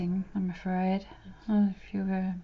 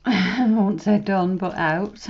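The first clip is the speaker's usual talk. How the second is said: laughing; 9 dB louder, slower than usual. Real, not acted. Laughs at her own joke.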